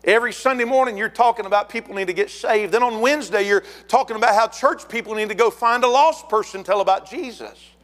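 high-pitched, insistent retold complaint; acted complaint. Couple objects to preacher's soul-winning emphasis, high-pitched complaint. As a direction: imitate a church couple's escalating grievance.

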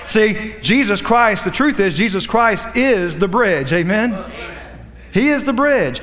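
rising, fervent preacher's cadence; preaching. Acted. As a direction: preach it up high with fervor. Raised preaching about Jesus the bridge.

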